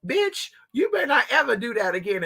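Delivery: laughing scolding. Retold or imitated.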